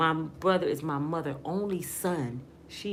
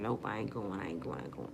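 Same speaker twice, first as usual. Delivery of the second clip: softer and lower on each "I ain't going"; fading mutter to self; 8 semitones lower, 7 dB quieter than usual. Retold or imitated. Refusing the trucker's calls, muttering to herself and fading. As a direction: talk yourself out of the date, trailing off. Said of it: "I'm like".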